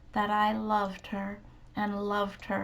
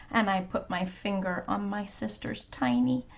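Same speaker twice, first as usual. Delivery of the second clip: plain reading voice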